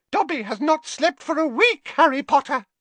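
a tired elf boasting; proud squeaky elf. Acted. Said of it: "said Dobby proudly".

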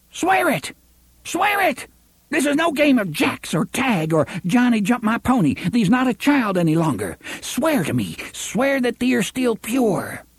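high, shrill, frantic aunt voice; shrill old aunt. Acted. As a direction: beg and demand, pitched high. Aunt shrilly demands an oath.

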